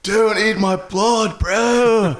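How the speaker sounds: slow, high surfer-dude vampire; Keanu doing Dracula. Retold or imitated. Podcaster mocking Keanu's Dracula accent, high and slow. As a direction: drag it out like a dopey Keanu. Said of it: "Has Keanu ever done an accent?".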